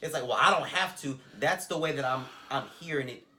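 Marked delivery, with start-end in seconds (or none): 0.2-1.2 dismissive. Retold or imitated. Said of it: "even when I read it through via text message".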